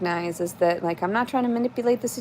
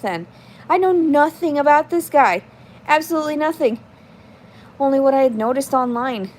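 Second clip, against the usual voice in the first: louder, higher exasperated rant; frustrated rant; 5 semitones higher, 5 dB louder than usual. Real, not acted. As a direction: vent the frustration at the viewers.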